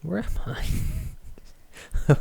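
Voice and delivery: whispered, slow where am I; unvoiced whisper to self. Real, not acted. Lost in the Kindle, whispering to herself slowly. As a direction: whisper it while searching the page.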